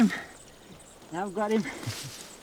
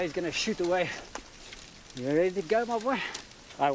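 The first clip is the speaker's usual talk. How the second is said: normal talking voice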